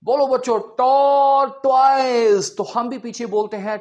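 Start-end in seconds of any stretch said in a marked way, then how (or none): 0.0-2.6 loud, slow teacher chanting "tor-toiz"; school teacher. Retold or imitated. Imitating a school teacher drilling children loudly. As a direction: lead the kids in a loud chant. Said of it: "ऊंची बुलवाते हैं हमें".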